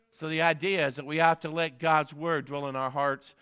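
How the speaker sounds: ordinary talk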